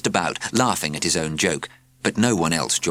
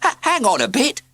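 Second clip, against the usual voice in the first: high, loud stammered protest; flustered cry; 4 dB louder than usual. A narrator's reading. Postman protests to the teacher, high and flustered. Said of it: "cried the postman".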